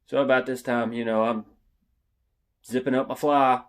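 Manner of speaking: ordinary delivery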